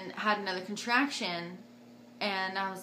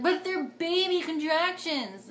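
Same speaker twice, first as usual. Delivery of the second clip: higher, drawn-out mock protest; 8 semitones higher, 4 dB louder, slower than usual. Daughter arguing with mom about contractions, mock protest. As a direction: put on a mild whiny objection, stretched out.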